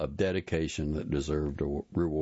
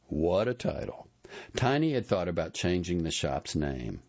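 plain reading voice

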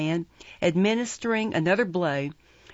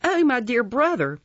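mild scream, high and louder; high-pitched outcry, only moderately screamed; 6 semitones higher, 5 dB louder than usual. A narrator's reading. Young woman accosting Oliver in the street, mildly screamed. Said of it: "screaming out very loud".

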